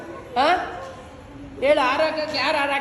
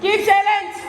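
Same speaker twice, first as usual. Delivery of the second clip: raised, higher-pitched command; calling for silence; 7 semitones higher, 4 dB louder than usual. Real, not acted. Host hushing the audience before a child performs, calling out. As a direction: call the whole room to order. Host orders the room quiet.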